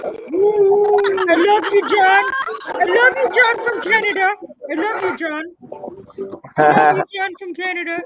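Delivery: lightly hammed-up loving shout; a hint of a gushing fan shouting; mock impression.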